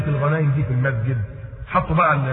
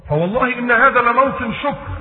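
urging quote; 8 semitones higher than usual. Retold or imitated. Voicing Abd al-Rahman's protest.

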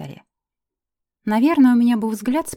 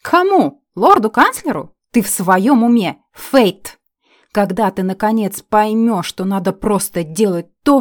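indignant shout; 9 dB louder, slower than usual. Acted. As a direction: yell it in disbelief.

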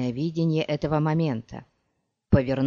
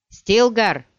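loud, higher shout of a name; 6 semitones higher, 7 dB louder than usual. Acted. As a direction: call your friend across the field. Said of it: "закричал Пол". (громкий крик, голос выше)

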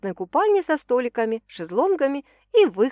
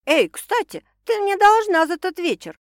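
outraged, higher and louder; indignant; 10 semitones higher, 6 dB louder than usual. A narrator's reading. Yanka reminding Sasha she owes her, indignant. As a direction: call her out on the debt. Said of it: "возмутилась Янка".

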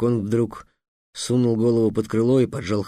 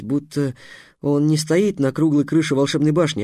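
ordinary delivery

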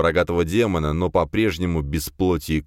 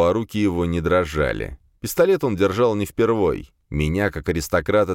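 ordinary delivery, nothing marked

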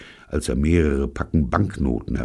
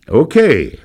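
loud okay; 12 semitones higher, 12 dB louder than usual. A narrator's reading. Gives up warning his colleague.

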